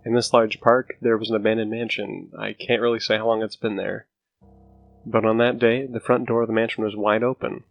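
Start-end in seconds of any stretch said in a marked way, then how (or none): none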